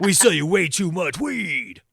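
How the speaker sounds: slow, drawn-out slogan voice; mock ad slogan. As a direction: stretch it out like a silly tagline. Mock slogan after Edgertainment.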